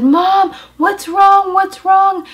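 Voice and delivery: put-on high, loud worried kids' voices; alarmed little girls. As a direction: daughters woken by screaming, asking worriedly. Daughters woken up, alarmed.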